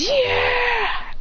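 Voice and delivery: high, drawn-out triumphant cheer; victory cheer. Acted. Winner celebrates as she's defeated, high long cheer.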